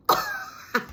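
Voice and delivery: very high laugh burst; shrieking laugh. Real, not acted. Reader laughing after singing the song.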